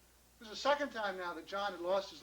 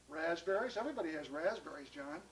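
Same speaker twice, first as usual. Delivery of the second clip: low-key, dismissive reply; unimpressed; 3 semitones lower than usual. Acted. Grocer turns down John's berries, unimpressed.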